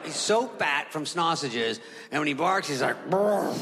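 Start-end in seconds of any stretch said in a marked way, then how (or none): 3.1-3.5 heavy, rolled dog woof; fat bark. Retold or imitated. Imitating Scooby fat from sausages, fat bark. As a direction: bark like an overweight wiener dog. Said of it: "It's a fat bark".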